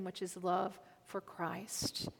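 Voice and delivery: breathy hushed whisper on the heart. Real, not acted. Preacher whispering to the congregation about Peter's heart. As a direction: lean in and whisper it. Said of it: "preacher drops to whisper".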